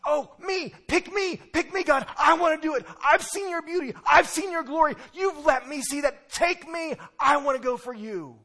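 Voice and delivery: high, loud, breathless pick me; eager volunteer. Acted. Isaiah begging God to choose him, high and eager. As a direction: beg to be chosen like an eager child. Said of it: "I see Isaiah saying".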